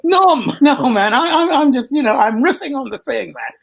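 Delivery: high breezy imitation of Will; Will's voice. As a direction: brush it off like a cool young guy. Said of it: "we went".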